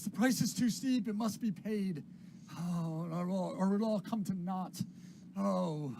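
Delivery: high, loud distraught lament; agitated old William. Acted. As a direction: cry out a dire warning. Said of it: "He says".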